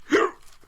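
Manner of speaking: sudden loud, high hiccup; a hiccup bursts out mid-sentence; involuntary hiccup, sharp and loud; real hiccup. Real, not acted. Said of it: "It's normal to have the hiccups".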